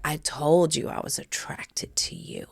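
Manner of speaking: husband whispering his attraction to her; husband's whisper. Retold or imitated. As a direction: breathe it as an intimate whisper. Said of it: "And he was like".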